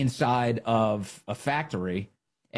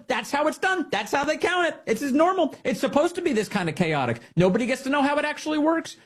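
mocking the interviewer; 12 semitones higher than usual. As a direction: squeak it out like a smug anchor. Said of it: "She's sitting there going".

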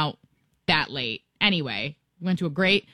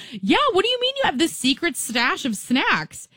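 imitating getting mad, pitched higher; replaying her annoyed disbelief; mock upset; 9 semitones higher than usual. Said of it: "I'm, I'm getting upset".

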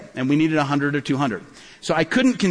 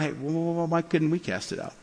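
much softer, stuttering question; timid stammer; 2 semitones lower, 7 dB quieter than usual. Retold or imitated. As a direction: ask shyly after a rebuke. Disciples timidly ask Jesus.

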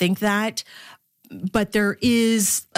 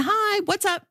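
mock brisk mom; 14 semitones higher than usual. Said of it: "It's always been".

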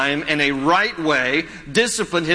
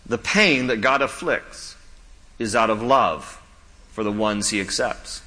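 slow, deliberate restatement; slow emphatic repeat; 7 semitones lower than usual. Shown as a demonstration. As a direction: say it again slowly for weight. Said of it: "Let me say it again".

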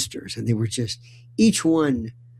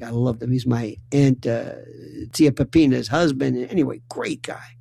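usual speaking voice

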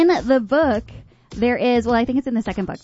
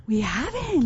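surprised gasp; 3 semitones higher than usual. Real, not acted. Realizing no costumes yet.

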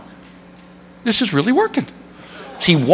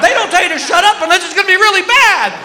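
mock panic; 13 semitones higher, faster than usual. Panicked aside.

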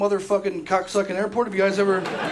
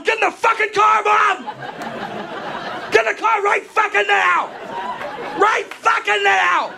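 frantic yelling; 11 semitones higher than usual. Retold or imitated. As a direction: scream it all out the window. Screaming at parents and cop.

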